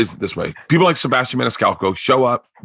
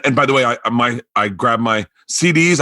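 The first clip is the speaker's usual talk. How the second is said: usual speaking voice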